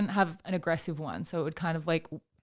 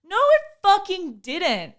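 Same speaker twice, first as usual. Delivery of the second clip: higher, slow, louder imitation of a cranky sleeper; mock grumpy denial; 10 semitones higher, 5 dB louder, slower than usual. Imitating boyfriend woken for a show, mock angry. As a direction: ham up his sleepy anger, drawn out. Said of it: "And you're like".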